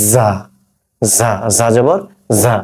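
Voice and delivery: "জা" with a long, heavy buzzing z; slow, drawn-out z on each "জা"; correct za demo. Shown as a demonstration. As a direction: hold the z, then open into "জা". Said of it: "হবে কি?".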